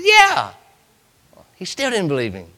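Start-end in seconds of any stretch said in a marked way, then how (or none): 0.0-0.5 high short reply; Jesus answering. Acted. Jesus to Peter, high short answer. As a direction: toss back a high yes.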